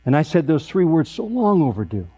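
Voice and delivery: ordinary delivery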